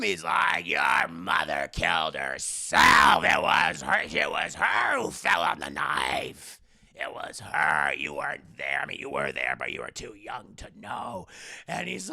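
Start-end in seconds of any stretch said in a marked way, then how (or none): 0.7-11.3 booming father. Retold or imitated. Father yells back that the mother killed herself, low booming shout. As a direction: bellow it down at him like an old brute.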